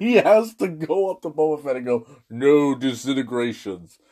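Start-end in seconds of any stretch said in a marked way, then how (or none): 2.3-4.0 low, slow Vader voice; a hint of a menacing Darth Vader, slow and low; Vader impression. Quoting Vader to Boba Fett.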